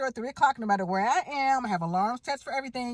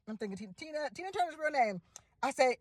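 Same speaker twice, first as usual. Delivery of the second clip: ordinary talk, nothing marked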